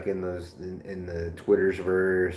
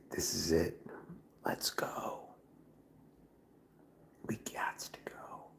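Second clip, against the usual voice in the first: whispered pep talk, fading softer; 2 semitones lower, 15 dB quieter than usual. Retold or imitated. Waking at one a.m., whispering to himself. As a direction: hype yourself up in a whisper.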